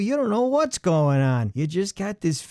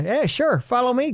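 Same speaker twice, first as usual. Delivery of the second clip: higher, eager cheerful staffer; mock upbeat store clerk, pitched up; chipper helper; 7 semitones higher than usual. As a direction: sound like the guy who's happy to help. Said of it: "you'll get the ... guy".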